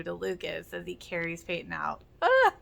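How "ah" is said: sudden loud, high yelp of heartbreak; anguished cry. Real, not acted. Viewer reacting to Keith's goodbye, loud pained yelp. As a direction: cry out as if the scene stings. Emotional reaction to episode moment.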